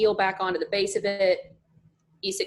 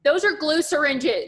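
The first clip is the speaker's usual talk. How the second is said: slow, high, loud acted protest; exasperated protest; 7 semitones higher, 5 dB louder, slower than usual. Daughter explaining to worried parents, exasperated protest. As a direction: spell it out, mock-indignant.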